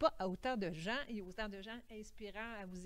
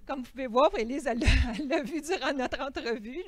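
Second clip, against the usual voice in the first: louder, higher, laughing while moved; laughing through emotion; 4 semitones higher, 9 dB louder than usual. Real, not acted. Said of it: "je suis un peu émue".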